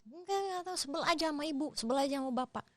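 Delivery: put-on high, sulky child's voice. Sulky child answering her mother, high and pouting. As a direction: pout it out like a small kid.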